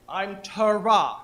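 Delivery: slow, loud "Tara" with the final "ra" stressed; emphatic pronunciation. Shown as a demonstration. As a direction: show the group a punched Tara. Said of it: "Pronunciation, people".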